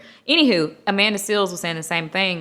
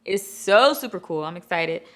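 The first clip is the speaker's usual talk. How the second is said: normal talking voice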